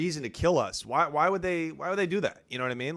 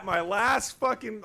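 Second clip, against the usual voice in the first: normal talking voice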